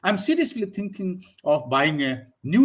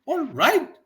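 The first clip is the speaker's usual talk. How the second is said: high "All right" rising at the end, louder; asking tone; 11 semitones higher, 5 dB louder than usual. Shown as a demonstration. Teacher showing that it means asking, rising question. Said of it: "you are asking".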